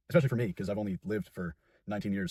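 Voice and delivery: ordinary delivery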